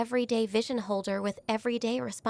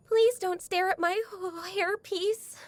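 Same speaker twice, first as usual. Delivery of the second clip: high, flustered and embarrassed; flustered plea; 7 semitones higher than usual. Acted. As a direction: squeak it out, wanting the stare to stop. Embarrassed about her hair.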